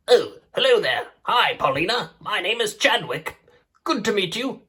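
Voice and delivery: put-on mild British-accented cheetah hello. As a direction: Chadwick greets Paulina in a British accent. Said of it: "made both of you with British accents".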